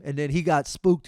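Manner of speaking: normal talking voice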